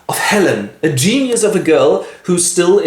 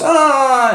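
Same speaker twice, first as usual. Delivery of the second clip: high gasp; 12 semitones higher than usual. Acted. Jane is stunned.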